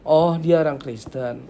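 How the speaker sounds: slow, impressed discovery; approving recognition. Shown as a demonstration. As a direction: draw it out as a favourable realisation. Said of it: "Beda ya, nadanya beda ya". (kagum, baru menyadari)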